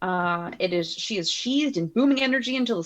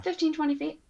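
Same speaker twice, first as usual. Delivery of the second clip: ordinary talk, nothing marked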